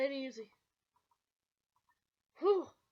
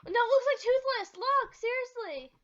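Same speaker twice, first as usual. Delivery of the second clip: excited insistence; 6 semitones higher, 7 dB louder than usual. Real, not acted. Urging friend to look.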